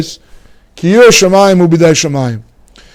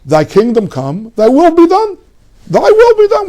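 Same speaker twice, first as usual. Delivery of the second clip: rising, intoned prayer line, pitched up; 10 semitones higher than usual. Retold or imitated. Teacher mocks a fatalist prayer, intoned high. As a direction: lightly parody a church prayer. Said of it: "I'll say this Extian thing".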